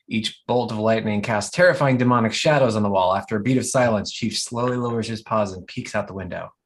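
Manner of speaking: ordinary delivery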